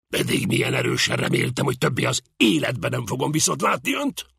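stern retort. A narrator's reading. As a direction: toss it at him sternly. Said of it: "vetette oda szigorúan". (szigorúan odavetve, hangosabban)

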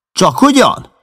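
jealous rival shouting back; shouted retort. Acted. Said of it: "kiáltotta Baldwin".

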